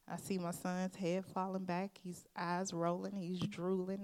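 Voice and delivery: ordinary talk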